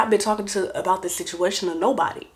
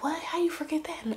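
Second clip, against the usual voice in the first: high, hushed incredulous whisper; whispered disbelief; 10 semitones higher, 9 dB quieter than usual. Retold or imitated. Mama reacting to the forgotten I D, high whispered disbelief. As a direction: imitate a mother whispering in shock.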